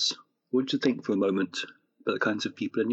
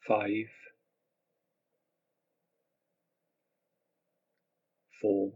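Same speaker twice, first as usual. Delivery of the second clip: guided meditation; 4 dB quieter than usual. Acted. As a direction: gently guide them into relaxation.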